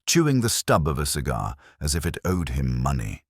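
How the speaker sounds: ordinary delivery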